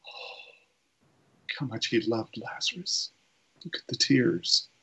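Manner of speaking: a moved onlooker, almost to themselves; tender murmur. Retold or imitated. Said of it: "They either thought".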